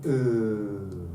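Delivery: OOH held long; vowel demonstration. Shown as a demonstration. Teacher shares a sound she likes, drawn-out vowel. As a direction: stretch OOH into one sustained sound. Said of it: "we like that sound".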